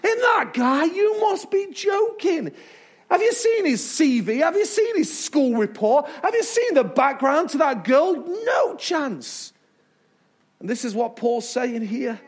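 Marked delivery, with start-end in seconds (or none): 0.0-9.5 high, drawn-out mock disbelief; hammed-up outraged sceptic; incredulous doubters. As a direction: scoff in exaggerated disbelief, pitch jumping up. Mocking doubters' scornful disbelief.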